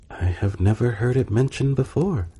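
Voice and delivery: a lightly voiced, fussy court servant; gentleman in waiting. A narrator's reading. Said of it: "said the gentleman in waiting".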